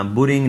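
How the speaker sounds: बुरिंग with a short "u" between b and r; wrong added vowel. Shown as a demonstration. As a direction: say बुरिंग as "bu-ring". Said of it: "नहीं पढ़ना".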